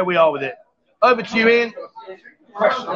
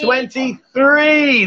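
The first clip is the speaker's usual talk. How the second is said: very slow, higher-pitched reveal; drawn-out announcement; 4 semitones higher, much slower than usual. Real, not acted. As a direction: stretch out each part of "Twenty-three". Announcing her age to the viewers.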